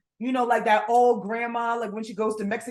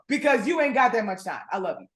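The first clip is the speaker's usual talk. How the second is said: ordinary talk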